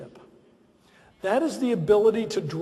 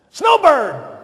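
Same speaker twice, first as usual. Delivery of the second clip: loud bright call of a nickname; 6 semitones higher, 8 dB louder than usual. Retold or imitated. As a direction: call him like the boss on the phone. President calling him on phone.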